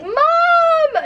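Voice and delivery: very high, drawn-out yell for mom; retold scream. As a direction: scream for your mother, long and piercing. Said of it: "I screamed my".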